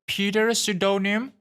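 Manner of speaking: high rising call of a name; nurse calling out. A narrator's reading. Nurse calls the waiting room again, high rising call.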